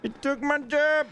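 high cartoon voice; South Park voice. Repeats the South Park bit.

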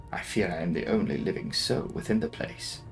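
ordinary delivery, nothing marked

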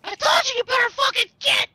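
loud, high hammed-up shout; shouted threat. Yelling at an enemy player.

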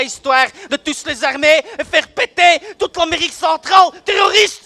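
builds from loud to a full shout on the last word; rising yell. Acted. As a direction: work yourself up until you're yelling. Cop rant building to a yell.

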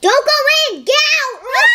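alarmed high-pitched order; urgent warning. Pretend. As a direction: call out to stop them at once.

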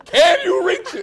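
high pleading mimic with laughter; imitating the caller. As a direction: squeak the plea through giggles. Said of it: "you want him around".

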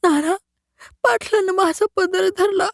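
high, piercing cry to her father; a terrified daughter crying to her father; shrieking complaint. Acted. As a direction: shriek out what he did. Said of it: "मंजुळानं किंकाळी फोडली".

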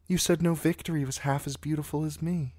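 put-on high girlish voice, wistful and eager; a smitten girl remembering, voice lifted high; eager young girl. A narrator's reading. Sansa reminds Sir Loras of the tourney, high and eager.

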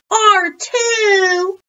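put-on high, loud childish retort; hollered comeback. A narrator's reading. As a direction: shout back in a kids' squabble. Class argues back with librarian.

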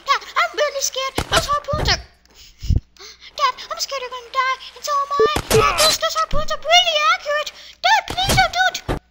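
put-on high, whiny child, half laughing; scared kid voice. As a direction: plead like a frightened little boy. Kid pleads with dad.

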